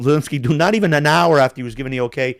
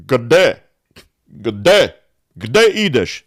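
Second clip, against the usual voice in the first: Serbian dialect; 2 semitones lower, 4 dB louder, slower than usual. Shown as a demonstration. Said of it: "Serbia proper".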